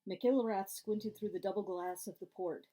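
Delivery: ordinary delivery, nothing marked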